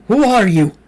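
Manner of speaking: high, loud shouted question; demanding. A narrator's reading. Facing the laughing creature, loud shout. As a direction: fling it out desperately. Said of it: "I demanded".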